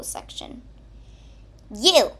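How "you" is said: sharp loud bark. Acted. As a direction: snap the word out loud. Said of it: "barked at Toilet Paper".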